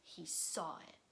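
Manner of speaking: soft whispered reveal; hushed reveal; suspense whisper. A narrator's reading. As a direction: whisper the discovery. Finding bear in dark place.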